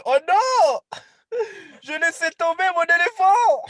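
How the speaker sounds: high, loud laughing cry of dismay; panicked yell. Acted. José the spider panics as the elephant falls, shouting. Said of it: "il fait".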